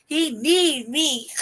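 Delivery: drawn-out, high desperate wail; mock desperation. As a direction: stretch it slowly, playfully desperate. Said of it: "You hear the desperation in my voice?".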